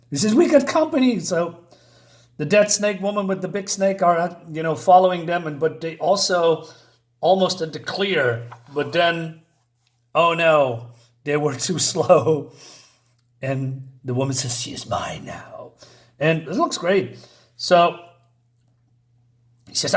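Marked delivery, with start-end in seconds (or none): 0.3-1.2 alarmed warning. Acted. Reads warning line. 14.6-15.7 low, soft, menacing whisper; creepy whisper. Acted. Dead snake mother claiming Penny, eerie whisper. Reads snake woman's line.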